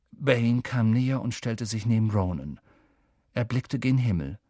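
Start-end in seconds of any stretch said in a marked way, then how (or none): none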